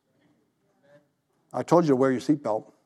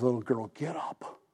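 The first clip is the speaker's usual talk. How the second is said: much softer, lower, tender; soft command to girl; 6 semitones lower, 13 dB quieter than usual. Retold or imitated. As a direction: gently wake a child with a soft, low voice. Jesus raising the girl.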